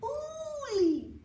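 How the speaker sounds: high loud repeated calls, building; calling out. Acted. Brothers search forest for lost Uli, high loud calling. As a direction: call his name across the forest. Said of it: "They called and called".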